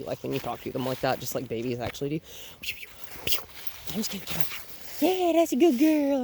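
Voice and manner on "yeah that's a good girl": much higher and louder, slow praise; happy praise. Real, not acted. Trainer praising the puppy for chasing the toy. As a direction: cheer her on.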